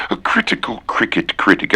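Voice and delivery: whispered twister. Shown as a demonstration. Whispering the tongue twister. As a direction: breathe it out in a whisper.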